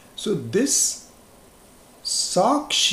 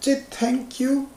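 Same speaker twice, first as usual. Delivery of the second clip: put-on parent prompting child; 12 semitones higher than usual. Parent teaching child manners.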